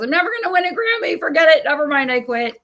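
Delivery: high, loud, rushed mock despair; exasperated giving up. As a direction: throw in the towel dramatically, tumbling out fast. Acted self-talk of giving up.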